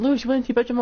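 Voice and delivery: high imitation of a chiding mum; mum scolding. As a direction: chide him like a patient mother. Mum voiced in story.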